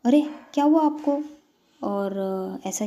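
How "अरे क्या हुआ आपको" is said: high, loud, concerned question; concerned exclamation. Acted. Prerna to the hurt little girl, concerned exclamation. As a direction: ask with startled concern. Comforting a crying child.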